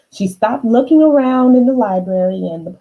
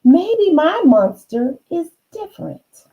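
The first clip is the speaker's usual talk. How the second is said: put-on hopeful girl; 10 semitones higher than usual. Marilyn answers her brother.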